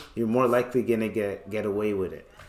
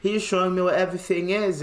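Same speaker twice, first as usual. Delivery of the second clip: high, quick mock British voice; British accent; 7 semitones higher, faster than usual. Friend explaining himself to police, British accent. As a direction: rattle it off in a put-on British accent. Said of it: "he's just talking like that".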